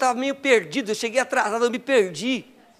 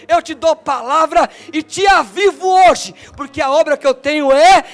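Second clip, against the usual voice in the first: preaching at full force; 3 semitones higher, 9 dB louder than usual. Acted. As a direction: thunder God's promise over the church. Preaching God's promise at full force. (pregação fervorosa em alta voz)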